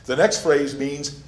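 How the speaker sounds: ordinary talk, nothing marked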